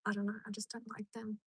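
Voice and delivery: hushed whisper; whispered confession. Real, not acted. Admitting to viewers she dislikes them, whispered. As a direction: whisper it like a guilty secret.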